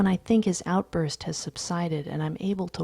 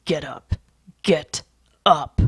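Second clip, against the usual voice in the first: urgent order, each word on its own; desperate urging; 6 dB louder than usual. A narrator's reading. Narrator orders Lewis off the floor, desperately. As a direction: split the words apart, louder as you insist. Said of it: "I whisper desperately".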